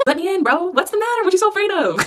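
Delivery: high, teasing taunt; mock pleading taunt. Taunting the person who blocked them.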